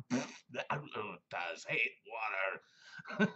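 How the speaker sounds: very slow growly cartoon beast; Tasmanian Devil voice. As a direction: drag it out like Taz. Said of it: "he was the Tasmanian Devil".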